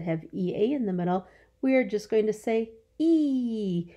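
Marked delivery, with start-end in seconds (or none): 3.0-3.9 "E" held long as a pure ee; long E sound. Shown as a demonstration. Teacher saying the vowel sound for children, long drawn-out "E". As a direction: stretch out "E" as one sustained vowel. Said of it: "is the long sound".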